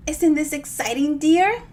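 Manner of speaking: delighted, bouncing on her toes; gleeful old lady. Acted.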